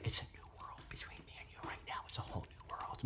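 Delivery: confiding whispered aside. Real, not acted. Vlogger confiding in a performer about their new bond, whispered. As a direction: drop to a quick, hushed whisper. Hushed aside to the person praised.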